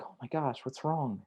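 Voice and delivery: put-on softly soothing a crying kid; tender comforting. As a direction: coo over a hurt child.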